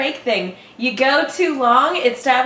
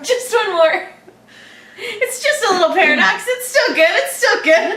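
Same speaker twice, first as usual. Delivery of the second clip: high, frantic laughing reassurance; hammed-up high-pitched begging; mock pleading; 9 semitones higher than usual. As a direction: insist up high, comically desperate. Acts out character justifying another paradox.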